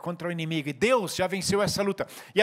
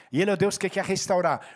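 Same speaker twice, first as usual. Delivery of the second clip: normal talking voice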